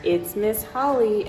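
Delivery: plain reading voice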